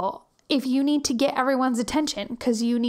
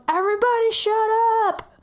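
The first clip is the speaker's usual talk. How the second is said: high, loud bratty yell; kid yelling; 10 semitones higher, 4 dB louder than usual. Retold or imitated. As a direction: shout to silence the room. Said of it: "If the kid yells".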